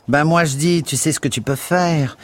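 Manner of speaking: high, loud disapproving charwoman; Mrs Biggs voice. A narrator's reading. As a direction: sniff it out high and prim.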